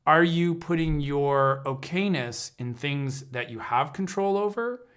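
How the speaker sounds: normal talking voice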